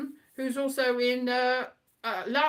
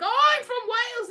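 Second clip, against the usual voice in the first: high, bright cry of recognition; pleased exclamation, higher and louder; delighted surprise; 11 semitones higher, 6 dB louder than usual. Retold or imitated. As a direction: jump up in pitch, thrilled to meet a fellow Welsh person. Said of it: "he's like".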